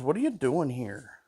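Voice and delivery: ordinary delivery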